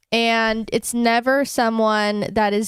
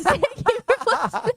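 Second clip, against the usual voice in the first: laughing; 10 semitones higher than usual. Real, not acted. Joking outro.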